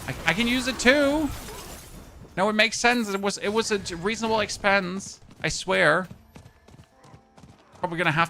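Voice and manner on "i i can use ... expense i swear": half-guilty protest; defensive excuse. Real, not acted. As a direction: insist it was sensible.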